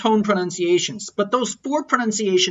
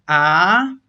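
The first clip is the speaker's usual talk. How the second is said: drawn-out "ah" that climbs in pitch; rising tone; 5 dB louder than usual. Shown as a demonstration. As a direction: lift "ah" steadily upward to the end. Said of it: "second tone is a rising tone".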